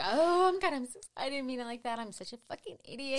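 high, loud, rushed apologizing guest; embarrassed party guest babbling sorry; flustered apology. Retold or imitated. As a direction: gush out a panicked apology, high and fast. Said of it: "starts apologizing".

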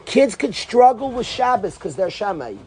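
usual speaking voice

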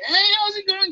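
high, loud happy hello; mock greeting to a friend he'd hug; cheerful greeting. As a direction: greet an old buddy with delight. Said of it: "I'd be like".

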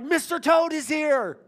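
high, slow, thrilled reveal; a dad telling his sleepy daughter the toad is back; excited announcement. Retold or imitated. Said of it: "and was like".

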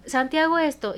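a hint of a mother laying down the law; authoritative mother. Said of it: "y de autoridad".

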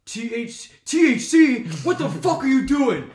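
loud, high-pitched outraged shout; yelling dad. Retold or imitated. Dad reading the cart label to his son, yelling. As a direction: imitate a furious dad, building to a full yell. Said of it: "is yelling at me".

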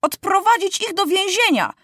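high-pitched command; boy king's indignant cry; crying out an order. A narrator's reading. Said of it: "krzyknął Maciuś".

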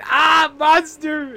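high, loud, slow frightened shriek; mock scream. Girl sees crying figure and screams, high loud mock shriek. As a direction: shriek at the monster in the dark.

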